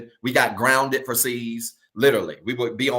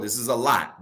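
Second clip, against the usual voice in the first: ordinary talk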